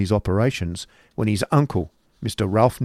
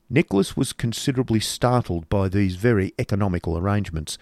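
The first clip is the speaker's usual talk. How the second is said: plain reading voice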